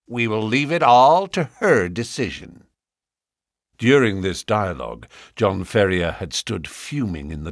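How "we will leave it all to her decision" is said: put-on self-satisfied young suitor; smug. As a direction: say it admiring yourself. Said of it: "smirking at his own reflection".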